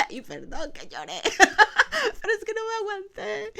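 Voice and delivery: real laughter breaking into a high voice; laughing apology. Real, not acted. Pastor apologizing to the church for having cried, laughing. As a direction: apologize through bursts of laughter.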